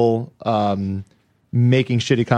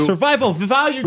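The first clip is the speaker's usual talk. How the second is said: pundit voice; 11 semitones higher than usual. Pretend. Mocking political pundit voice.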